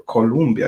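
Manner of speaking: Colombia said as "co-LUM-bia"; common mispronunciation. Shown as a demonstration. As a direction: say Colombia with an "oo/uh" middle, like Columbia. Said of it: "It's not Colombia".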